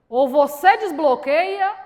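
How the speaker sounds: loud, slow retold command; stern ultimatum. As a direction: lay down an ultimatum, stretched and forceful.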